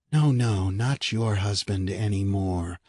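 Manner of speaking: sinister inner voice. A narrator's reading. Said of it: "another more terrible voice within her shrieked".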